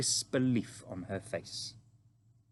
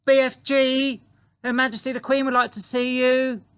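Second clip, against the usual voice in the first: high, loud call across the garden; child calling at full voice; 11 dB louder than usual. Acted. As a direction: holler to someone far off, very high. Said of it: "and called out".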